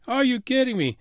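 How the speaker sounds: normal talking voice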